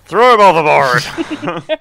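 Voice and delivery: slow, loud captain's command; booming order. Acted. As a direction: bellow the order like a pirate captain.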